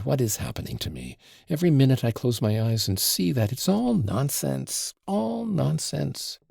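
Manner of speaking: lightly voiced anxious society lady, pitch often lifted; a hint of a flustered mother wailing over her troubles; fretful mother. Mother complains to Alyosha about her fretful daughter, lightly voiced and fretful. Madame Hohlakoff's distraught lament.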